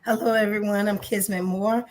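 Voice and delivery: usual speaking voice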